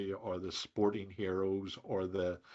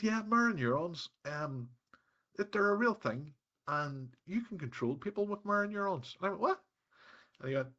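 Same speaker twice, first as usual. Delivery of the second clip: raised, excited retold voice of a once-grumpy man; imitating the bloke; 7 semitones higher than usual. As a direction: play the bubbly networking bloke, pitched up. Retelling grumpy guy explaining neurons.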